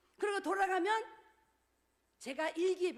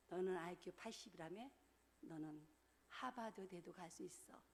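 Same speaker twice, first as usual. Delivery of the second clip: gentle encouragement; 8 semitones lower, 12 dB quieter than usual. Retold or imitated. Teacher encouraging student.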